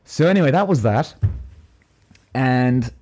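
ordinary talk

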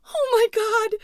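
very high, full-force shriek; terrified scream. A narrator's reading. Louise seeing the creature, screaming into phone. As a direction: scream it in pure horror. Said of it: "screamed into her phone".

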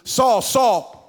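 high, loud, sudden call of the name; sudden startling call. Acted. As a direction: cut in with a startling shout. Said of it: "he's walking like".

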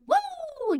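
high squealed woo, a pretend party cheer; mock cheer. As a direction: squeal it up high like you're bouncing off the walls. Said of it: "We may not say".

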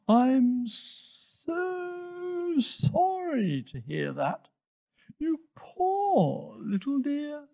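very slow, high, drawn-out hissing; hissing snake. A narrator's reading. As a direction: croon it with long sibilant hisses. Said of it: "hissed the snake".

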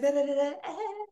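put-on high, sing-song girlish babble.

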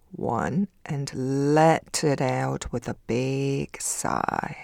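calm, soothing breathing guide; guided meditation. Acted. Leads a meditation.

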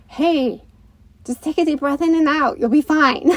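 chirpy mock big brother telling him he'll be fine; son's voice. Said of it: "It's like".

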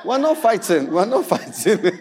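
words breaking into laughter; laughing retort. Retold or imitated. Preacher acting out a refusal in the elevator, laughing. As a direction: laugh through the mock protest.

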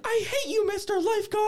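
very high, loud squealed mock yell; mimicked kid yelling. Colleague imitating the kid he heard from afar, high mock yell. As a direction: shriek it like a tiny furious child, for laughs. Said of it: "He was yelling".

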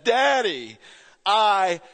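retold cry of a small daughter at bedtime; desperate child's cry. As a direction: wail it like a desperate little girl. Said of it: "a desperate, loud voice said".

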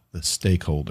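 low, soft, menacing; ominous low voice. Pretend. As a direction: say it like a horror trailer. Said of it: "it sounds like a vampire killer now".

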